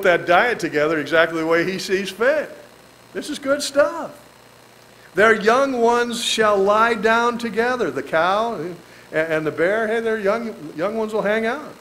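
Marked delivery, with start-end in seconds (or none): 5.1-7.9 slow, solemn reading of the verse; scripture reading. Acted. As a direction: read the verse slowly and solemnly.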